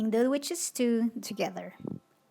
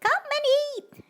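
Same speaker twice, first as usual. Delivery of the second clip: high, loud welcoming shout; high loud welcoming witch; 7 dB louder than usual. Acted. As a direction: call the guests in like a witch host. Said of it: "shouted Big Witch".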